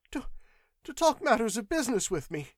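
high, hurried clerk's voice; stumble, then rush the answer out; shrill, frightened excuse; nervous stammer. A narrator's reading. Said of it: "shrill with taut nerves".

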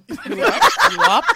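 high giggly teasing voice; laughing mock voice. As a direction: tease it through laughter.

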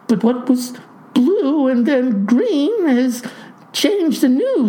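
high, agitated protest; mounting high-pitched bewilderment; in quite a state. A narrator's reading. Clerk baffled by the farmer's changing hair, in quite a state. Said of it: "in quite a state".

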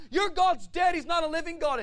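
loud, high-pitched taunting shout; Goliath's taunt. Acted. Goliath comes out to mock Israel, shouted taunt. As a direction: bellow it across the battlefield.

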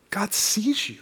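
whispered, let it land softly; a hushed whisper of reassurance. Real, not acted. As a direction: breathe the words to the room. Hushed emphasis on key line.

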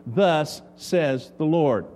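slow, weighty proclamation; solemn intoning. Acted. Preacher solemnly quoting the prophet, slow and weighty. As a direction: lean on each word like scripture.

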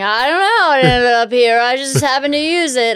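put-on high, sheepish kid voice; mother imitating her five-year-old dodging a question. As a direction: shrug it off like a small child. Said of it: "That's our five-year-old explaining something".

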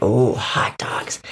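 slow, low, savoring coyote; greedy coyote. Acted. Coyote spots the hot dogs, low greedy voice. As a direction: draw it out low, relishing the find. Coyote eyes the picnic food.

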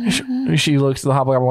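usual speaking voice

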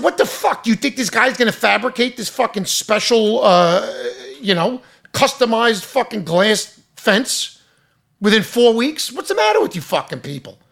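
mock-incredulous tirade at the inspectors; half-acted angry disbelief; retold outburst.

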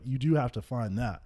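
ordinary talk, nothing marked

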